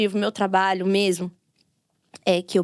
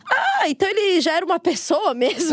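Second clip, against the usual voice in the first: higher-pitched, acted-out wonder; mock realization; 7 semitones higher than usual. Podcast host voices society discovering childhood, in mock realization. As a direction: play a dawning discovery, pitched up.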